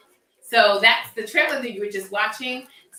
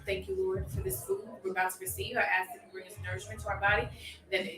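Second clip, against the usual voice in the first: soft, hushed mealtime prayer; saying grace; 2 semitones lower, 12 dB quieter than usual. Real, not acted. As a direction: pray quietly over the food. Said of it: "y'all heard that right here".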